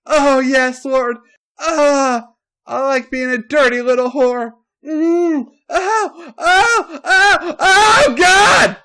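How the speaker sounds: high, loud moans building to a shouted cry; climactic moaning. A narrator's reading. Stephanie building to a loud climax, high and loud.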